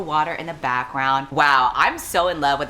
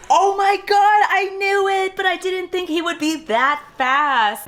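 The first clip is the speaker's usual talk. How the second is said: much higher, loud thrilled gasp-talk; fangirling at a twist; excited shock; 4 dB louder than usual. Real, not acted. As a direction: squeal with delight at the reveal. Gasp at surprising scene.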